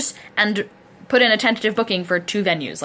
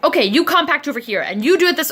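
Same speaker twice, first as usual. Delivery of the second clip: bossy directing; 8 semitones higher, 4 dB louder than usual. Retold or imitated. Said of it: "directing a small army of people".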